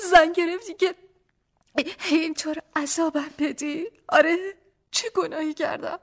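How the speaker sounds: high, tearful sobbing plea; crying wife. A narrator's reading. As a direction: weep while asking what sin she committed.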